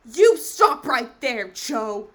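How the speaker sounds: slow, very loud scream; screaming at Cho to stop. Acted. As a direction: yell to cut her off.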